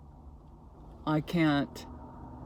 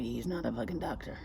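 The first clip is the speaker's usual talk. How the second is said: usual speaking voice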